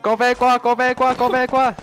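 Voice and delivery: high, loud repeated callout; urgent call. Real, not acted. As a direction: shout it to the squad fast. Calling teammates to cover.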